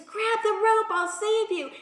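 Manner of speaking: calling down. Retold or imitated. Helicopter rescuer to man on the roof, calling down. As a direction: call out urgently, pitched well up.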